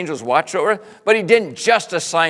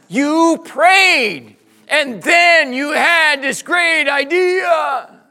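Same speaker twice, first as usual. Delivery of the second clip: loud, slow, higher mocking shout; 9 semitones higher, 7 dB louder, much slower than usual. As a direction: shout it slowly at a fool.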